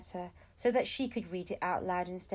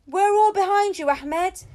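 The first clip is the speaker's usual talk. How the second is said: high, loud, excited cheer of support; a passer-by rooting loudly for Ahmed; cheering stranger; 9 semitones higher, 12 dB louder than usual. A narrator's reading. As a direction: call out your support to the boy.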